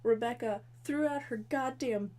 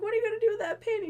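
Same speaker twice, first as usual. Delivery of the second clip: high, loud, fast mocking jab; mock Devin jab; 11 semitones higher, 6 dB louder, much faster than usual. Devin needling Val about the painting, mock jab. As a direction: rattle it off teasingly. Acted quarrel in recap.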